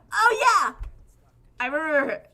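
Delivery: loud high oh yeah; delighted sudden recall; excited recognition. Real, not acted. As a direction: burst out on recognition. Recognizing her drawn elf.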